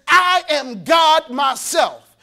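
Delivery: slow, loud, mocking shout of the sinner. Preacher mimics a sinner shouting he is God. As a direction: belt it out like a proud sinner.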